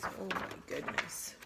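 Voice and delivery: breathy mock-scared whisper. Whispered aside after title.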